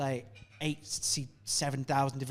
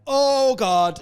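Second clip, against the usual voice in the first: higher and louder, panicky; panicked exclamation; 9 semitones higher, 10 dB louder than usual. Retold or imitated. Terrified comic waiting side stage, panicky outburst. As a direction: blurt it out in a panic. Nervous before huge crowd.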